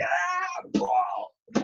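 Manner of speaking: very high strained cry on the punches; exhausted cry. Retold or imitated. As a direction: cry out as if spent and still punching. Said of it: "it's like".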